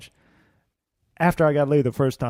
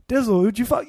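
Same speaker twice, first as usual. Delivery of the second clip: lightly voiced friend, pitched up; slightly higher mock friend's question; curious friends; 4 semitones higher than usual. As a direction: imitate a pal asking for gossip. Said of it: "then they'd be like".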